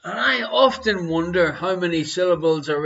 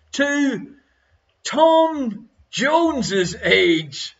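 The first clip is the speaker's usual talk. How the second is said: slow, drawn out; 4 semitones higher, much slower than usual. Real, not acted. Slow emphatic quiz reading.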